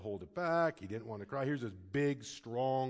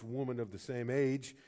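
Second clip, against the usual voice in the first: ordinary talk, nothing marked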